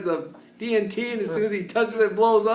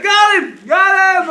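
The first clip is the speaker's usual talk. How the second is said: high, loud triumphant yell; retold shouting; imitated scream; 8 semitones higher, 9 dB louder than usual. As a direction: holler like hunters celebrating a hit. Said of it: "these guys screaming".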